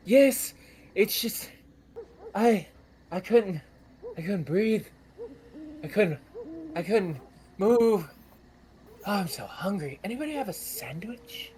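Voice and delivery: high, halting, gasping voice; breathless halfling. Acted. Freed librarian telling the party his ordeal, high and halting. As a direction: stammer out the ordeal, still shaken. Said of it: "he goes".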